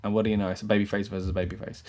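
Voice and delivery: ordinary delivery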